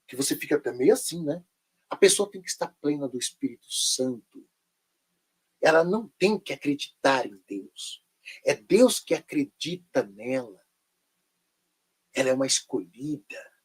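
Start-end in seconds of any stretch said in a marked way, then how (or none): 1.9-13.5 mystical preacher tone. Retold or imitated. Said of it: "eles põem uma entonação na voz".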